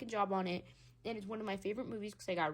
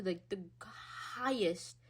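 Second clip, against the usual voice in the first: ordinary talk, nothing marked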